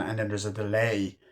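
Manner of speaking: ordinary talk, nothing marked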